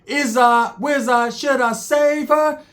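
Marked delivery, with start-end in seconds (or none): 1.3-2.6 mock pleading. Comic sketch, acted inner voice.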